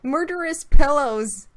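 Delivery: loud, punchy announcement of the topic; dramatic exclaim. Real, not acted. Cohost introducing the pillow story, dramatically loud. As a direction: boom it out like a horror movie title.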